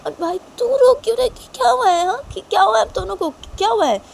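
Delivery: very high, loud frantic questioning; panicked girl demanding what happened; alarmed Shanaya. Acted. Shanaya finds both of them crying.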